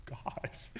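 unvoiced whisper of dread. Dad whispering as the sons beg for the game. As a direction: breathe it out, dreading. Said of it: "I'm like".

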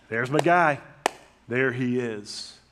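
loud cheer, then slow stretched second line; cheering welcome. Retold or imitated. Dad cheering his kids home from work, loudly. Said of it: "I'll cheer for them".

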